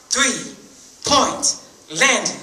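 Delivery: very slow, stretched out; slow drawn-out. Acted. Buzzard naming the deadly landing, very slow. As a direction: savor the name.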